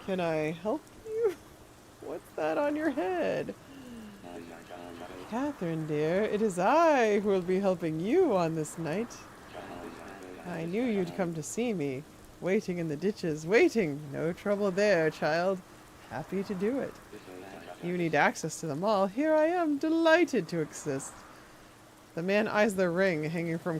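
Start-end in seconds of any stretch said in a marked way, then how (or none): 2.0-3.5 character question. Acted. Character dialogue, voiced. 5.3-21.1 lightly voiced sinister old man, syrupy; a hint of a creepy gentleman. Voicing creepy old man NPC.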